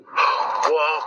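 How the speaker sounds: sharp gasp then whoa; startled gasp. Real, not acted. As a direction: gasp in shock. Hears a voice at grave.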